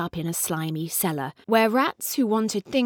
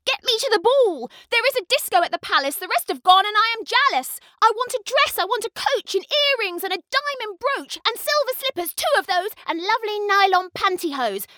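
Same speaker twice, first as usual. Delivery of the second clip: stamp and demand everything; spoiled shouting; 11 semitones higher, faster than usual. A narrator's reading. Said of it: "and shouted".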